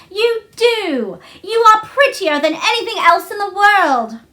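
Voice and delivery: delighted. A narrator's reading. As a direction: gush over the robin in delight. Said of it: "she cried out".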